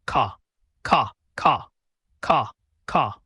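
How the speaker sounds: short spoken crow caws; mock cawing like a crow. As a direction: imitate a crow calling. Pip imitates a crow.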